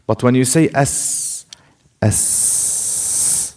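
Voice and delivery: "as," hissed out as pure breath; breathy s demo. Shown as a demonstration. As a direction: let "as" escape as unvoiced hiss. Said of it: "it's breath".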